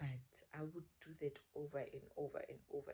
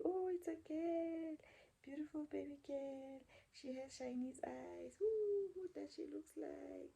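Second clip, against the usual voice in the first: much higher, sing-song nurse cooing over a newborn; gushing nurse; 12 semitones higher than usual. Retold or imitated. Nurses showing the mother her new baby girl, gushing high and sweet. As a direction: coo like a delighted nurse, slow and high.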